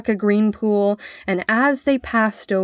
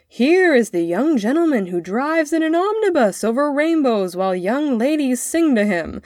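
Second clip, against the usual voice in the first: higher, sing-song ridicule; mocking father; 6 semitones higher, 4 dB louder than usual. A narrator's reading. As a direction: parade the boy's tale with scornful irony. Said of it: "said his father grimly".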